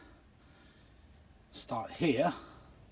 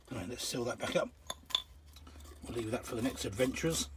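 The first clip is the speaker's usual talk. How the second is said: ordinary delivery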